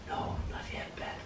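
soft unvoiced whisper of Lady Death; Death's whisper. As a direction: breathe the words like a gentle spirit. Lady Death answers the dying man.

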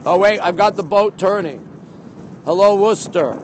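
normal talking voice

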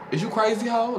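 high squeaky mock yell. Vlogger imitating the bar stranger yelling, high mock yell. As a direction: mimic the stranger yelling in her face. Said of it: "this bitch yelling all in my face".

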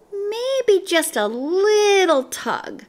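high, drawn-out tempted musing; coaxing yourself, stretched out and high; tempted thought. A narrator's reading. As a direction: toy slowly with a naughty idea. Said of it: "he thought".

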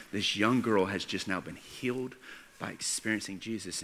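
normal talking voice

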